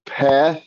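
PEREK as peh-rek, short eh twice; correct short vowels. Shown as a demonstration. Teacher giving students the right version, PEREK with two short ehs. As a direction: open short eh in both halves of PEREK, slowly. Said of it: "Eh and eh".

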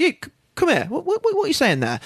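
high, curious vicar calling a stranger over. Retold or imitated. Vicar outside the prison calls the ranting man over, high and curious. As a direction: pitch it up like a nosy vicar beckoning. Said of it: "vicar guy sat outside".

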